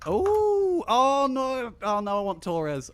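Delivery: high, drawn-out oh no; torn, high-pitched lament; dismayed wail. Real, not acted. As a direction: wail it high and loud, then ease down. Torn over rival favorite pick.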